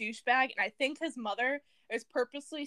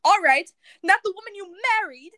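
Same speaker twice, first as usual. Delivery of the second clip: loud, higher retort in attempted British; hammed-up British quarrel voice; [name], attempted British accent; 6 semitones higher, 11 dB louder than usual. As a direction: argue back loudly, faking a British accent. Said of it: "your attempt at a British accent".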